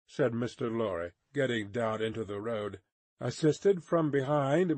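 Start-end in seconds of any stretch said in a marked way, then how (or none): none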